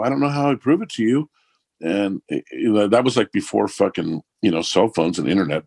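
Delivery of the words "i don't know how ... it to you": exasperated. Retold or imitated. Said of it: "I go".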